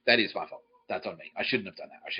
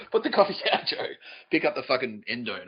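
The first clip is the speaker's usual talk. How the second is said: mock boss's order; 2 semitones higher than usual. Comic berates Joe like a sales boss, mock commanding. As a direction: order him with raised pitch.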